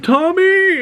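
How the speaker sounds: high, loud joyful call; happy reunion shout; calling out Tommy. Retold or imitated. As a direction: shout his name across to him.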